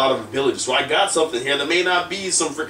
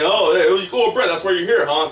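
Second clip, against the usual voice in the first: much higher, louder mock chummy hello; mock fanboy; 8 semitones higher, 5 dB louder than usual. Wrestler mocking how they'd greet Bret, lightly hammed up. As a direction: ham up a buddy-buddy greeting. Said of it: "just hanging".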